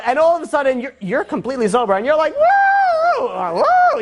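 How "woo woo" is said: very high, long party whoops; gleeful whoops. Retold or imitated. Sober friend joining the drunk one, mock gleeful whoops. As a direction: let out high, contagious "woo"s.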